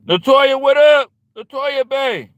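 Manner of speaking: loud, high call-out greeting. Real, not acted. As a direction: call across the room to Latoya. Calling out to chat member.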